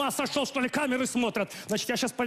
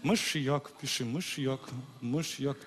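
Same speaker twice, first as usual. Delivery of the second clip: secret prompt; 7 semitones lower, 6 dB quieter, slower than usual. Acted. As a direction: lower and softer, hinting on the sly. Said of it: "Я тебе щас подскажу".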